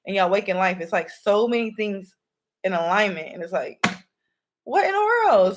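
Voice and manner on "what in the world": high astonished what in the world; stunned by all the signs, high and loud; amazed. Real, not acted. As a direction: shoot up in disbelief.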